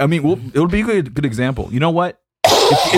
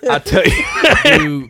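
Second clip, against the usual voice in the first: mock preacher; 6 dB louder, much slower than usual. Preacher tag line, laughing.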